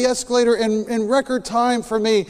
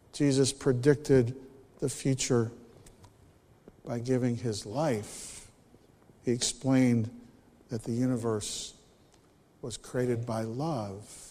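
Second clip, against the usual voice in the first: hushed closing; 9 semitones lower, 13 dB quieter than usual. Real, not acted. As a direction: let it die into a whisper. Sermon closes in whisper.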